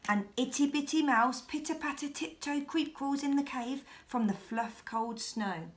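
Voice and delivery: ordinary delivery, nothing marked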